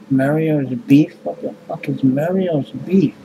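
normal talking voice

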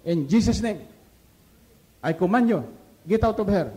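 loud commanding exorcism; commanding rebuke. Retold or imitated. Brother Panilo rebuking the demon, loud commanding voice. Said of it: "sabi ni Brother Panilo".

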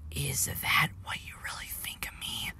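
a breathless, unvoiced whisper from the dark; eerie whisper from the old engine; ghostly whisper. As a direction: whisper it like a spectre. Ghostly engine speaks first.

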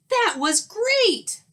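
very high, slow, thrilled words; wowed storybook skunk; amazed delight. Acted. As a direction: savor it with wonder.

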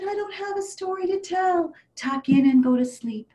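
grandma voice. Acted. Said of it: "And Grandma said".

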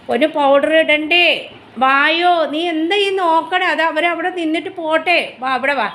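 high, slow coaxing voice for her dog; a mother cooing to her pet, slow and high; sing-song pet talk. Real, not acted.

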